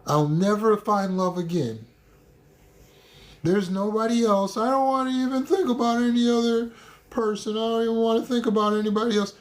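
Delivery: high, wailing mopey voice; whiny self-pity. Retold or imitated. Mocking the listener's heartbroken talk, high whiny wail. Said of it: "Never say".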